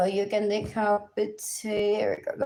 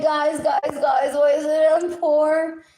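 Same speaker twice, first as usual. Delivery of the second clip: loud, high call for attention; raised voice pleading why she is poor; shouting out; 5 semitones higher, 5 dB louder, slower than usual. Real, not acted. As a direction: call out to guys far away. Calling out to the FBI.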